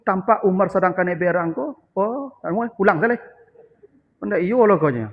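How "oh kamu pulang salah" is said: usual speaking voice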